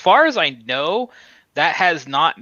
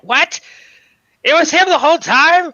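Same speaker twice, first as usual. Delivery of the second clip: much higher, loud outraged disbelief; mock shocked Joker; 7 dB louder than usual. Fan retelling Joker learning Batman's identity, high loud cartoon shock. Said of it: "he's like".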